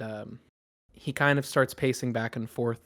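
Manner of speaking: ordinary delivery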